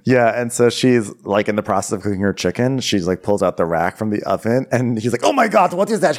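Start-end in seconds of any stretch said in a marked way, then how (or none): 5.2-6.1 high-pitched alarmed gasp of words; mock alarm. Cook's companion spotting something on oven rack, mock alarm. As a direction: exclaim in hammed-up shock, pitched high. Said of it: "They're, like, alarmed".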